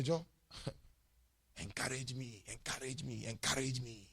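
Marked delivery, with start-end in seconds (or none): 1.6-4.1 softer, whiny begging repeated; mock whining. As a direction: mimic a needy child.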